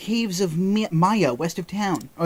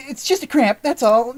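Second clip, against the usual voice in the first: high embarrassed voice; 8 semitones higher, 4 dB louder than usual. Acted. Character excuse voiced.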